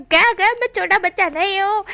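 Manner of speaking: high indignant shout. A narrator's reading. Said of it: "ऐसा चिल्लाते हुए इबो फिर से कहता है". (ऊँची आवाज़ में चिल्लाकर विरोध)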